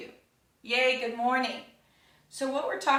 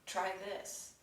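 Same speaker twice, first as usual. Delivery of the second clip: very soft, slower, like a quiet inner prompt; near whisper; 10 dB quieter than usual. Acted. Meditation teacher voicing a solution that comes to you, almost whispered. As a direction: say it softly, almost whispering an idea. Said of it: "almost like a whisper".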